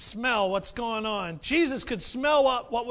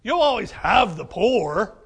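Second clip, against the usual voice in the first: sarcastic; 8 dB louder, slower than usual. Retold or imitated. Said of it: "sarcasm coming from Jesus".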